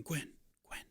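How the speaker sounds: whispering woman. Acted. Cloaked stranger whispering to the landlady at the door, hushed. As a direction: call her name under your breath. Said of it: "female voice kind of whispering out".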